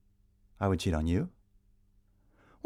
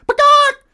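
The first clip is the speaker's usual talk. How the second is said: peacock cry; 14 dB louder than usual. Acted. Sudden imitated bird cry.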